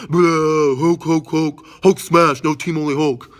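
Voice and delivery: loud, slow brute roar; loud, slow Hulk smash chant. Retold or imitated. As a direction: bellow it like a raging green giant. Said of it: "and Hulk's like".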